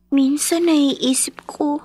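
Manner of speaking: slow, breathy whisper to herself. Acted. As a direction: confide it under her breath. Child's private thought.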